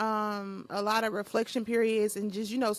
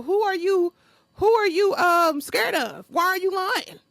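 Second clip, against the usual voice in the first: exasperated; 7 semitones higher, 5 dB louder than usual. Real, not acted. Exasperated challenge to liars.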